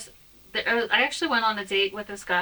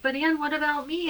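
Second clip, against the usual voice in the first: higher, pleading mock whine; whiny friend; 8 semitones higher than usual. Woman mimicking a clingy friend, higher. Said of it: "And she's like".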